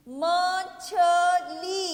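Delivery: मछली loud and very slow, syllable by syllable; drawn-out syllables. Shown as a demonstration. Teacher modelling मछली for children, loudly drawn out. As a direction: stretch each beat of मछली, long final ee. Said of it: "देर तक बोल रहे हैं".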